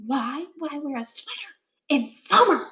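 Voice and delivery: breathy unvoiced whisper. A narrator's reading. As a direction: whisper to a friend. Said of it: "Stuart whispered to Scotty".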